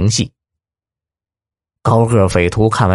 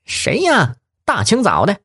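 calling out; 10 semitones higher than usual. Acted. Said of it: "冲外面喊道".

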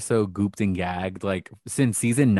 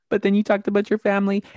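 much higher, sing-song mock interviewer voice; imitating Rue's questions; 10 semitones higher, 4 dB louder, faster than usual. Mocking the host as she probes a contestant about parents, high-pitched. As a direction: lift into a high, prying mock-tender tone. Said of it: "she was talking like this".

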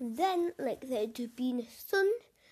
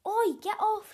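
put-on sharp raised shout of a teen; 7 semitones higher, 4 dB louder than usual. A narrator's reading. Teen boy shouting at his floating brother. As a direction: call out to push him off. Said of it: "shouted Jimmy".

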